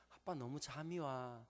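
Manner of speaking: sleepy. Retold or imitated. Tired daughter at bedtime.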